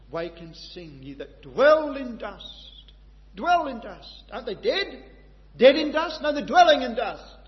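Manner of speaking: loud, high preaching, building with fervour; full-force preaching. Acted. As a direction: preach it out to the hall with conviction.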